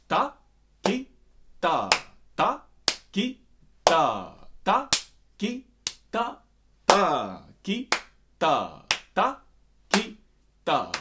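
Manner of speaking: ta, ki, ta as separate, evenly spaced beats; detached rhythmic count with loud "ta" and short "ki"; takita on larger scale. Shown as a demonstration. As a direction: give each syllable its own beat, punching "ta". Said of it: "on a larger scale".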